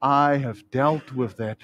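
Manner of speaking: low, slow, tender reassurance; steady, low-pitched fatherly comfort; loving voice of God. Acted. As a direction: welcome the guilty one calmly and firmly. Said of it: "The voice of God says".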